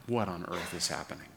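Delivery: soft, bewildered plea; preacher voicing Jesus' confused cry, hushed; hushed despair. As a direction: ask it quietly, lost. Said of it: "he cries out".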